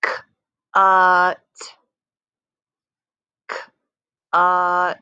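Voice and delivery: drawn-out blending word; slow stretched word. Shown as a demonstration. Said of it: "say some words like a turtle".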